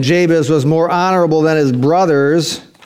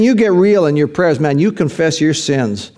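normal talking voice

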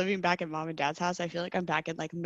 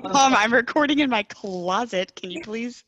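loud, slow plea to Mom; calling out to Mom; 3 semitones higher, 6 dB louder than usual. Real, not acted. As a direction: call through the door, exasperated. Calling out to mother.

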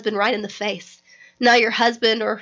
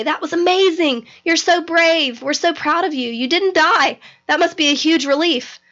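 higher, bubbly cheering praise; gushing friends; 6 semitones higher than usual. Retold or imitated. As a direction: gush like proud friends at the finish line. Friends gushing after the fight.

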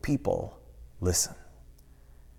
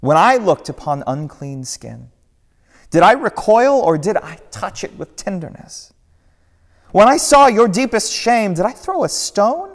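Jesus, rising passion; 10 semitones higher, 6 dB louder than usual. Acted. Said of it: "Another voice".

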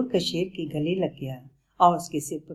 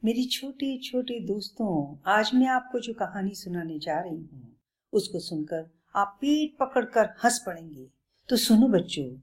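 put-on bright storyteller voice for kids; reading to children; 4 semitones higher than usual. A narrator's reading. Storyteller opening a tale for little friends, lively. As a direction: draw the little ones into the tale.

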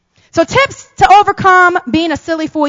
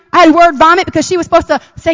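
ordinary talk, nothing marked

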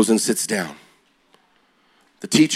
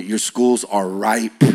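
slow emphasis; 2 semitones lower, much slower than usual. Real, not acted. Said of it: "slow emphatic repeat".

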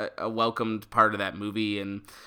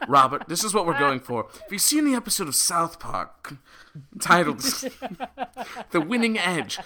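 lightly acted director giving notes, rising in pitch; imagined movie director; 7 semitones higher than usual. Director briefing his two actors, mock-earnest pitch. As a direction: explain the scene while holding back laughter. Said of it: "the director of this movie going".

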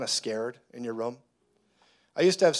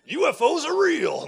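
high, loud mock announcement; teasing the congregation, high mock-dramatic claim; mock proclamation; 14 semitones higher, 6 dB louder than usual. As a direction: proclaim it as a joke bait. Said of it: "No, I'm just kidding".

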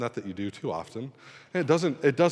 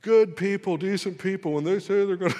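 pitched-up retold rant; mock rant; 8 semitones higher than usual. Ranting at his fiancée, mock indignant. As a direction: lecture her in a hammed-up huff. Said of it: "I just kinda lit into her".

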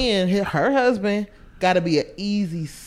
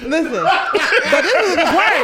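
high, slow words through real laughter; laughing; 8 semitones higher, 3 dB louder, slower than usual. Real, not acted. Trying to finish the story for the group, laughing. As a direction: push the story on while cracking up. Cracking up while telling the story.

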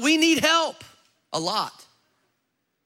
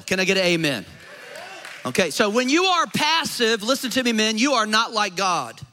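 louder, driving sermon delivery; fiery preaching; 4 dB louder than usual. Acted. Pastor exhorting the men of the church, loud and fiery. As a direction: preach hard to rouse the men.

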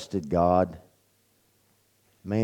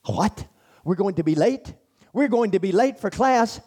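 much higher, startled mock disbelief; mock alarm; 11 semitones higher than usual. Roommate faking shock at being late, mock alarm. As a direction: act shocked to tease a worried friend.

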